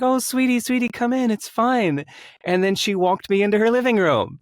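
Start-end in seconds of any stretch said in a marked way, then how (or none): 0.0-2.0 high-pitched, cooing comfort; imitated motherly reassurance. Woman consoling a crying visitor at her door, imitated high reassurance. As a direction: imitate soothing someone upset, voice lifted high.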